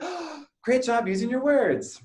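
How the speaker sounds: a dad praising his daughter for using words, delighted; delighted praise. Retold or imitated. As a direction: praise her like it's the best thing ever.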